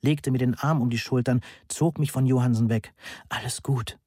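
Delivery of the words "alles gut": soft, hushed comforting words; lightly hushed, not a full whisper; whispered reassurance. A narrator's reading. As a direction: say it softly into someone's ear. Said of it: "flüsterte sie in mein Ohr".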